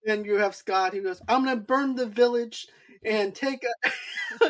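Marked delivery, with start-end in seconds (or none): 1.3-4.4 bad acting. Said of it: "he's horrible at acting".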